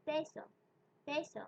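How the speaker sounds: peso with a clean p and no puff of air; Spanish p demo; Spanish unaspirated p. Shown as a demonstration. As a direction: hold back the breath on the p of peso. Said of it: "letting out as little air as possible".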